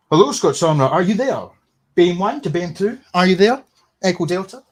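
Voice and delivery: loud, high calling out; mock radio call. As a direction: hail a missing teammate like a radio operator. Mock radio call to absent co-host.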